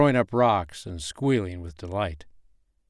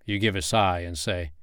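usual speaking voice

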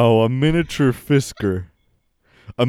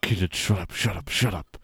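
hushed; 4 semitones lower, 7 dB quieter than usual. Acted. Hushing a kid on call.

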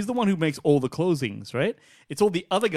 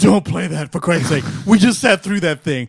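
mock protest; 2 semitones higher, 6 dB louder than usual. Mock protest against the soundboard.

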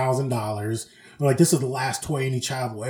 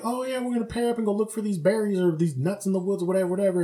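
higher, softly sing-out instructions; camp supervisor; 5 semitones higher, 5 dB quieter than usual. Retold or imitated. Supervisor pairing up kids at nature camp, breezy. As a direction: rattle off camp chores in a higher voice.

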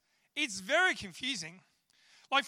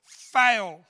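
shouted at the top of his lungs, much louder; loud yell; 14 dB louder than usual. Retold or imitated. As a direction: bellow it across the rows to mock a mate. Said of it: "yells out at the top of his voice".

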